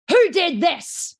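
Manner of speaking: loud, high, drawn-out angry demand; threatening parent demanding the culprit; scary tone. Acted. Controlling parents finding the drawn-on wall, scary angry tone. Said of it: "That's a scary tone".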